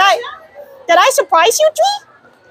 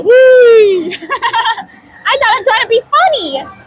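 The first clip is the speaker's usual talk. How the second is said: ordinary talk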